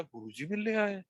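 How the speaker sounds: ऊँची आवाज़ में हैरानी से पूछना; चौंककर, सुर ऊपर उठाते हुए; shocked question. Retold or imitated. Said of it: "तो मैं बड़ा शॉक था". (गुरुजी के आने की बात सुनकर चौंका हुआ सवाल)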